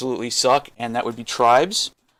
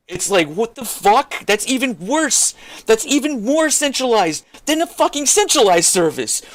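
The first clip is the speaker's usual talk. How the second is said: furious rant; 8 semitones higher than usual. Real, not acted. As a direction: blow up in disbelief at the whole scene. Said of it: "let me calm down for a second".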